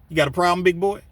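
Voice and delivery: loud, high confrontational bark at the big man; loud challenge. Retold or imitated. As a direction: pick a fight out loud. John challenging Archie.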